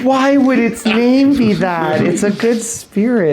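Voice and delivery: high, loud, drawn-out protest; loud scandalized objection; indignant disbelief. Real, not acted. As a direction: protest the insult, laughing outrage. Mock outrage at a rude name.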